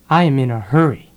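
normal talking voice

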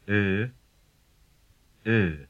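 "Ö" sustained alone, then repeated after a pause; difficult vowel demo. Shown as a demonstration. Teacher has learners listen to it, isolated held "Ö". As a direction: model "Ö" as a held, separate vowel. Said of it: "Listen to it".

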